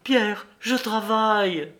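high, drawn-out exclamation; bursting out. A narrator's reading. René can't hold back her news to Pierre, exclaiming. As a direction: cry it out, unable to hold it in. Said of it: "Elle n'y tint plus et s'écria".

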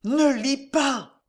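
high, drawn-out desperate plea; horrified woman pleading in a high voice; panting plea. A narrator's reading. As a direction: beg him slowly, in terror. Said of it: "murmura d'une voix à peine distincte".

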